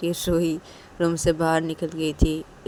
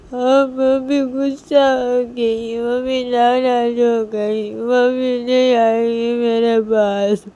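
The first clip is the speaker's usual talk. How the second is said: put-on high, drawn-out child's wail; loud crying; 7 semitones higher, 10 dB louder, slower than usual. Liya complaining that mummy hit her and left, crying loudly. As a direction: bawl the words out, long and loud. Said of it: "लिया बहुत ज़ोर से रोने लगती है".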